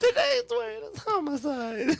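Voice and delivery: slow, very high puppet voice; Elmo. Acted. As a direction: squeak it gleefully, close to giggling. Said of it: "Now we're gonna do Elmo".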